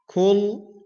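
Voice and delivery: Kul with a light front k; the mistaken kul; wrong kul. Shown as a demonstration.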